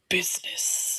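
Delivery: hushed, whispered reverence on the word; Sam the Eagle. Acted. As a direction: whisper it like a grand revelation. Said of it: "from The Muppet Christmas Carol when Sam the Eagle".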